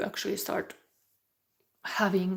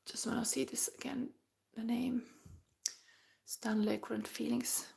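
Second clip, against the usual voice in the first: whispered aside; 2 semitones higher, 9 dB quieter than usual. Real, not acted. Reader checking the question quietly.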